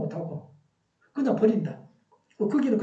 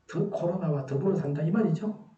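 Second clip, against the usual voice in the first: usual speaking voice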